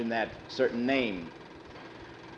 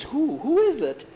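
eager; 14 semitones higher, 4 dB louder than usual. Acted. Eager boy asks for name.

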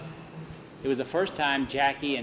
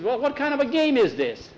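high-pitched, indignant complaint; baffled player's raised-voice objection; indignant protest; 10 semitones higher than usual. A narrator's reading. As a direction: protest to the referee in disbelief. Big player protests to referee.

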